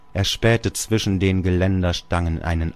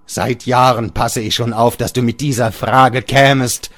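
louder, higher accusing voice; bitter father; 6 semitones higher, 7 dB louder than usual. Acted. Father tells Georg he waited for this. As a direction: lay the trap open.

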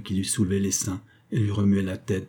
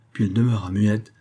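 ordinary delivery

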